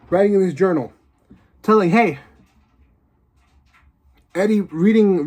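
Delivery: usual speaking voice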